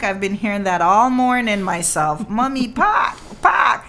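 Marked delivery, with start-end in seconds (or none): 2.4-3.8 put-on toddler voice. Mother imitating her toddler demanding the park, a high whiny child voice. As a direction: whine it like a toddler wanting out.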